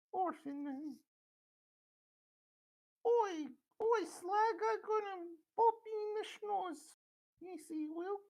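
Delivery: very high, squeaky Cockney orphan voice. Acted. Streamer imitating the Cockney punch girl for his friend, high Cockney squeal. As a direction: squeal it like a scrappy Cockney street kid. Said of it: "talks like Cockney British orphan".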